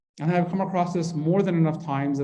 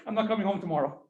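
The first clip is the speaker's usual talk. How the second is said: laughing; 5 semitones higher, 8 dB quieter than usual. Pretend. Reacting to being yelled at.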